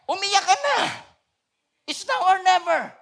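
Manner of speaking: high, loud fervent preaching build; full-force preaching. Acted. Pastor urging the congregation, fervent loud preaching. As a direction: preach it with rising urgency.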